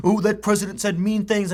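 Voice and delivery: put-on tearful whine, lightly done; mock almost crying. Host mimicking TV people upset by the president, mock almost crying. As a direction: imitate someone nearly crying over nothing. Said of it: "and almost crying".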